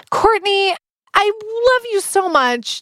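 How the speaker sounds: high, gushing, slow. Real, not acted. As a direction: lay the love on thick. Gushing farewell to guest.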